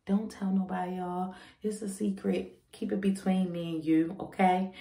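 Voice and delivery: hushed, confiding aside; playful secret. Pretend. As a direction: let them in on a little secret, softly.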